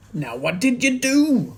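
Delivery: high, loud alarmed demand; anxious Mister Cranky. A narrator's reading. Mister Cranky presses George, high and loud. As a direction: demand an answer in a panic. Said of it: "cried Mister Cranky".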